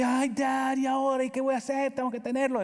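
put-on high pleading teen daughter voice; whiny daughter. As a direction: play the daughter begging Dad. Daughter begs father for retainer.

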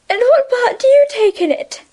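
teasing schoolgirl. A narrator's reading. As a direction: toss the question out lightly. Francine asks, teasing.

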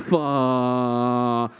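long stretched-out swear word; long held curse. Retold or imitated. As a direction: drag the word out endlessly. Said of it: "holds it for about thirty seconds".

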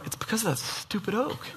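soft mock-annoyed grumble about the oak; grumbling under breath. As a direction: mutter it to yourself, annoyed.

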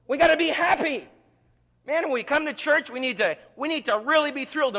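high, loud, hammed-up cheer; put-on enthusiasm. Churchgoer insisting church must be happy, hammed-up excitement. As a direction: push the happiness, high and insistent.

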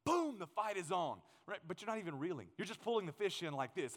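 usual speaking voice

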